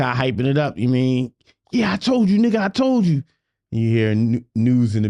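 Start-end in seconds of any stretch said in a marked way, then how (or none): 1.7-3.2 high, excited gloating; mock hype. [name] hypes the crowd after his verse, high and gloating. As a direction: crow it like a proud hype man.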